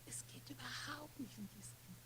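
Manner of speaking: hushed, near-whispered divine answer; voice of God. Retold or imitated. God answers the mother about her child, hushed. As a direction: speak very softly, as God answering. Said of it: "die Stimme Gottes".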